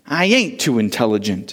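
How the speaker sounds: ordinary talk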